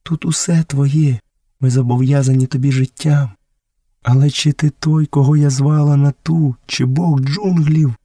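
high, slowed, reverent woman's voice; awed village mother. Acted. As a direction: offer everything humbly, then ask in wonder.

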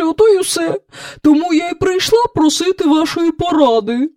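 high-pitched, pleading cow voice; plaintive. A narrator's reading. Cow begging the king for advice after days of dancing, plaintive.

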